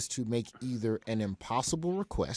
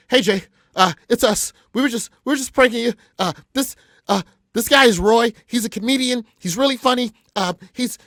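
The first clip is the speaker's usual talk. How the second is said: high, loud, stammering plea; frantic panic; 13 semitones higher, 12 dB louder than usual. Retold or imitated. Producer jumps on mic to calm the rapper, frantic panic. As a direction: trip over yourself begging him to believe it's harmless.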